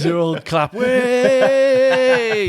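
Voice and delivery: hammed-up crowd roar; a big high mock cheer of the crowd. Crowd cheering naked mate.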